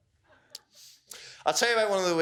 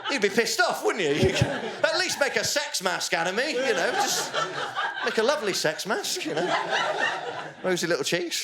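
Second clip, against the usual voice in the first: mock indignant victim; 8 semitones higher than usual. Indignant victim imagining a better use.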